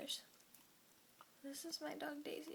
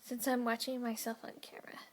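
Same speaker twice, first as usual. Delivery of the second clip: whispering; 3 semitones lower, 9 dB louder than usual. Real, not acted. Whispers throughout.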